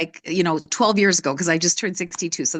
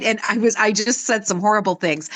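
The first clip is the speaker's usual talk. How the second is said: ordinary talk, nothing marked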